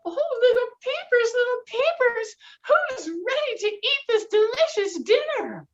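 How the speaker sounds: very high, loud hen call; little red hen calling. Acted. Hen calling her chicks to dinner, very high. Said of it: "And the little red hen said".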